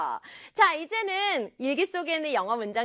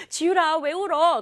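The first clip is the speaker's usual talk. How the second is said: raised, louder questioning; concerned mother; 5 semitones higher, 5 dB louder than usual. Acted. Mom asking Jiyul why she cries, raised concern. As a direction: call out to the child in concern. Mother asks crying daughter, acted.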